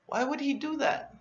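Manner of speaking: surprised, high-pitched thought; puzzled thought. Retold or imitated. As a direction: voice the jealous disbelief in your head. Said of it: "I think".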